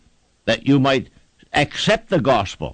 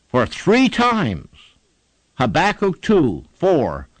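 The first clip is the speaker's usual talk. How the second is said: slow emphatic reference; 3 semitones higher, slower than usual. Acted. Preacher stressing the reference.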